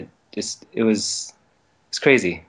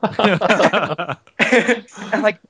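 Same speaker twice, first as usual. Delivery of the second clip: ordinary talk, nothing marked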